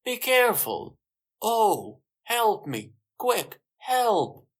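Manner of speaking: high, loud cry for help; shout. A narrator's reading. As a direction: call out urgently for help. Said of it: "Then Sue shouts".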